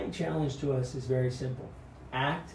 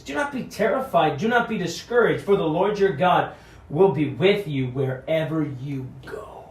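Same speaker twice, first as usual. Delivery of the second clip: preaching scripture; 8 dB louder than usual. Acted. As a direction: declaim it like a preacher at full force. Opening scripture proclaimed loudly.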